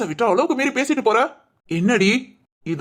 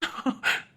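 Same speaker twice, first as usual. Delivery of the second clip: soft laugh; 9 dB quieter than usual. Real, not acted. Husband laughs.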